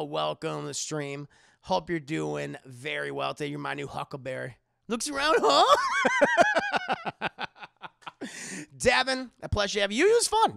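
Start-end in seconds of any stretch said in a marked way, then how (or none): 4.9-7.5 high, loud, laughing. Real, not acted. Joking then laughing. 10.1-10.5 playful high. Real, not acted.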